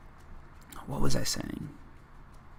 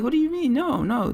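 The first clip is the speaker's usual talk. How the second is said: high-pitched mock protest; mock incredulous; 12 semitones higher than usual. Tourist correcting locals about Monster, mock incredulous. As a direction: act out a baffled objection, pitched way up. Retelling his protest to the French.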